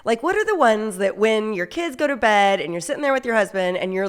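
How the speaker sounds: ordinary talk, nothing marked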